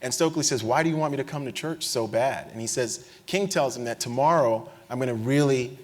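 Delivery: ordinary talk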